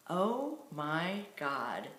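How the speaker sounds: very slow, drawn-out retold reaction; imitated slow-motion amazement; mock astonishment. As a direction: stretch each word out in mock awe. Retelling boyfriend's quick-witted mock astonishment.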